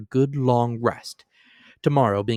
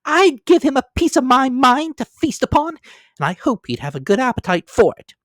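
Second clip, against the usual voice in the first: high, loud, heated mother's voice; a flushed wife venting about a miser, much higher and louder; indignant wife; 7 dB louder than usual. A narrator's reading. Wife scorning the stingy employer, high indignant voice.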